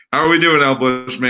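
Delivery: lightly voiced radio host greeting; radio host impression. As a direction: sell it like a talk-radio host. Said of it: "don't ever start off the show with that impression".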